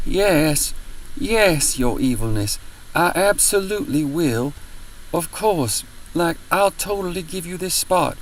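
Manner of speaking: high mock-servile voice; bow and scrape with open sarcasm; sarcastic groveling. Mocking the rude couple, high sarcastic fawning.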